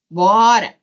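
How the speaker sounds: ordinary talk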